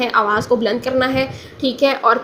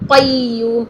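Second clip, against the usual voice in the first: normal talking voice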